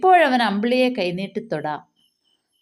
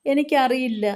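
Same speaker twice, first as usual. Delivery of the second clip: understated tearful reply; a touch of weeping; sobbing, broken voice; 3 semitones higher than usual. A narrator's reading. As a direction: answer through mild mock sobs. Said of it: "തേങ്ങലിൽ അവൻ്റെ ശബ്ദം മുറിഞ്ഞു".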